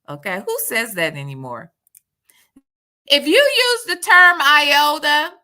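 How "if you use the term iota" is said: loud poll call. Real, not acted.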